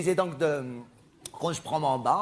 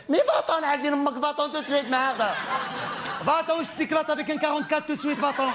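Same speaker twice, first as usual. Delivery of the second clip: furious shout; 9 semitones higher than usual. Acted. Performer yelling at Nadine to leave, hammed-up fury. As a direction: bellow at her to get lost.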